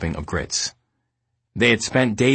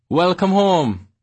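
put-on loud, high Jamaican accent bellow; 9 semitones higher, 4 dB louder, much slower than usual. A narrator's reading. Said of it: "she bellowed out to us in her Jamaican accent".

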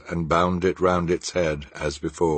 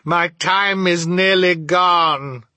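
high, loud, slow wail; ghostly cry; 12 semitones higher, 6 dB louder, slower than usual. A narrator's reading. The ghost warns Scrooge time is short, crying out. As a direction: wail it urgently, drawing out the end. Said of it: "cried the ghost".

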